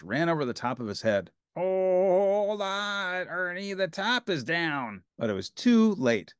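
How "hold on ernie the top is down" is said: lightly voiced Bert. A narrator's reading.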